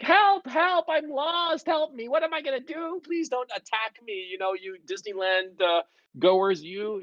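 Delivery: very high, hammed-up helpless wail without much volume; mock panicked screaming. Grown adult lost in Disneyland begging for help, mock panic. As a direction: imitate a grown adult pleading in silly panic. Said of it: "started screaming".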